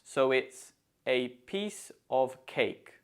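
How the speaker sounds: "piece" and "cake" stressed, slow and deliberate. Shown as a demonstration. Teacher showing learners to stress "piece" and "cake". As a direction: lean on "piece" and "cake", let "a" and "of" fall away. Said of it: "the stress falls on piece and cake".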